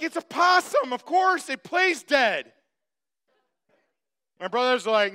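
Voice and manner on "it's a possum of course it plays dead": high, loud retort, slowing at the end; acted-out indignant insistence; mock exasperated protest. Retold argument, he protests to brothers.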